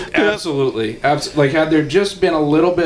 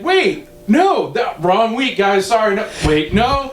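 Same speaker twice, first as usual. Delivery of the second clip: mock flustered backtracking; 7 semitones higher, slower than usual. Mimics friend's flustered texts.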